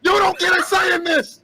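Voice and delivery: high, loud raging yell; furious shout. Acted. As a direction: roar it out in fury. Franz raging at the others.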